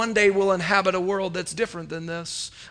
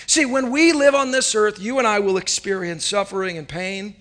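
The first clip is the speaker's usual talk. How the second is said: ordinary talk, nothing marked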